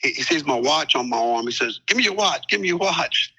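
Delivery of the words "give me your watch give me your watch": demanding. Retold or imitated. Robber demanding watch.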